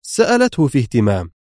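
usual speaking voice

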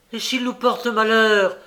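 mock-anxious exclamation; alarmed cry. A narrator's reading. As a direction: blurt out the dread of bad luck. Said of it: "s'écria Makoko".

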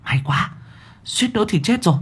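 panting relief. A narrator's reading. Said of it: "Nó vuốt ngực hổn hển".

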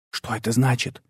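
hushed whisper. A narrator's reading. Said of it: "шёпотом спросил я".

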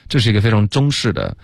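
usual speaking voice

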